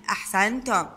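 ordinary talk, nothing marked

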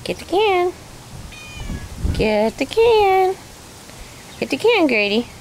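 high sing-song pet voice; teaching a parrot. Real, not acted. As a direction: coax the bird, high and sweet. Coaxing a parrot to talk.

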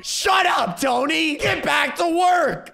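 high, loud scolding yell. Acted. Child boss yelling at Tony to please dad, high shout. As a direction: yell an order at a worker. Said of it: "I have to yell at Tony".

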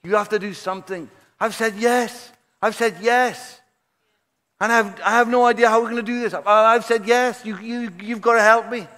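high, loud frantic plea to God; begging God for help, near panic; desperate prayer. Retold or imitated. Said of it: "He says, "God".

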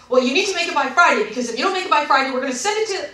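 loud, high, rushed warning; pushy imitation. Rep warning customer about Friday deadline, pushy. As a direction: rattle off the threat as the rep.

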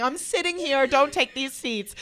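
loud retold announcement. Standing up to guard the seats, loud frantic announcement. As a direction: call it out to claim your spot.

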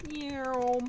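playful meow; cat meow. Pretend. Imitating a cat.

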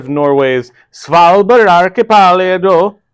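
put-on slow, showy Norwegian accent. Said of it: "trying to say it with, like, a Norwegian accent".

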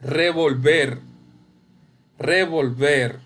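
"Revolver" with the final VER stressed; re-vol-VER, each syllable measured, weight on the end; stress on last syllable. Shown as a demonstration. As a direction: sound out "Revolver" leaning on VER. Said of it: "es la sílaba tónica".

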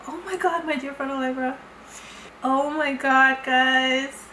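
ordinary talk

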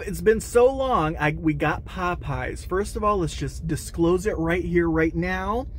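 normal talking voice

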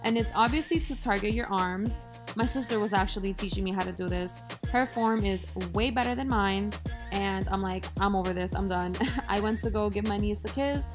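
ordinary talk

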